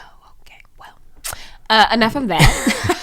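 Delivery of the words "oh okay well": soft whisper. Acted. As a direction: whisper it quietly. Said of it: "Why are you whispering?".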